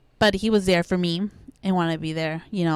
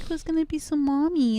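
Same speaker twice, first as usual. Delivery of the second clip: higher-pitched, lightly imitated child's question; young daughter asking; 9 semitones higher than usual. Mother retelling her daughter's question about the baby, lightly imitated child. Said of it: "And I was like".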